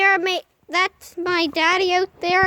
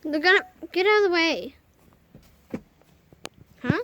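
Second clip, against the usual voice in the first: ordinary talk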